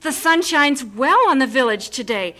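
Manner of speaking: loud ceremonial welcome; formal hearty welcome; loud greeting. Acted. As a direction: call out to the guests. Greeting the village women.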